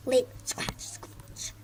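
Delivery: voiceless hissed lice-jump noise; whisper. Acted.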